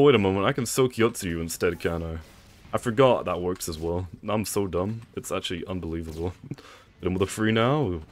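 usual speaking voice

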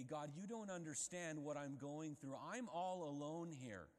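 loud, high self-pitying whine; mimicking our excuses to God, hammed-up whining; mock whining excuses. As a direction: whine like a sulking victim.

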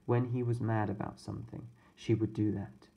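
plain reading voice

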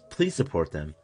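ordinary talk

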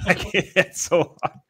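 high-pitched, giggly protest; laughing. Real, not acted. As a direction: give up through laughter. Cracking up after failed accent.

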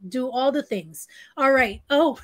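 ordinary talk

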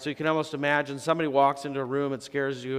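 ordinary talk

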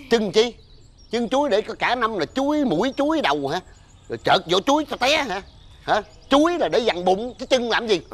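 high-pitched indignant tirade; indignant scolding. Acted. Father berating someone over bananas as a New Year offering, indignant.

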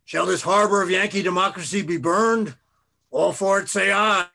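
loud, high rallying cry; echoing yell. A narrator's reading. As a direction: orator yelling for the crowd's vote. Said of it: "he yells, his voice echoing".

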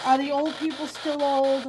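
usual speaking voice

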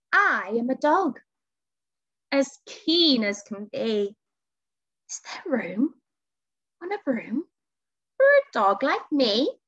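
lively, swooping pitch with questioning lifts; eager dog. A narrator's reading. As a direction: ask eagerly like a hopeful dog. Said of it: "then eagerly said".

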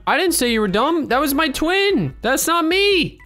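high, drawn-out whiny denial; mock indignant protest. Mock protest when accused.

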